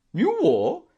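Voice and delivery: high, loud shocked aunt; dismayed cry. A narrator's reading. Aunt hearing of the new war, dismayed. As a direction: cry out with pitch leaping up. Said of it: "cried my aunt in dismay".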